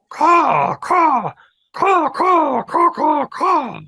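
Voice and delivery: very high, loud crow cries; crow caws. A narrator's reading. Old crow flying down to trapped pigeons, loud cawing. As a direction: caw loudly, urgent as a crow. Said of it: "flew down and said".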